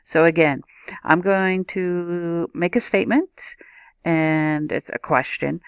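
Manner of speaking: ordinary talk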